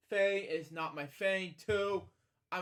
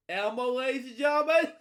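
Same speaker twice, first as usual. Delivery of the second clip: higher, louder game-show announce; mock announcer; 7 semitones higher, 8 dB louder, faster than usual. Vlogger jokingly announces Elmo to viewers, loud mock announcer. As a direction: present it like a game-show host.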